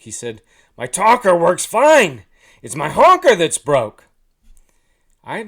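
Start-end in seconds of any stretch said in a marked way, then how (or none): 0.8-4.0 very loud goose retort; exasperated goose. A narrator's reading. Goose correcting the clueless hunter, exasperated holler.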